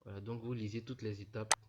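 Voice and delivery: ordinary delivery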